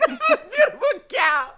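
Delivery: words broken by laughter, pitched high; laughing. Real, not acted. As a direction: giggle through the words, slowly. Laughing while repeating the joke.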